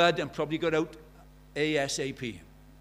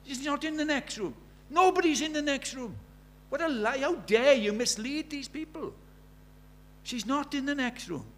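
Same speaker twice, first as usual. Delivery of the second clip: imagined angry shout; 11 semitones higher than usual. Retold or imitated. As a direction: shout down the lying vicar. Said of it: "I felt like shouting".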